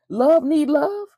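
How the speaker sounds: can't believe what he's hearing; stunned rhetorical question; incredulous. Real, not acted. Disbelief at the lyric.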